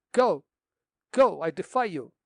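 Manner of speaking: loud, defiant cry; defiant shout. Acted. Girl defying the captain's threat, shouting loudly. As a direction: shout him out the door.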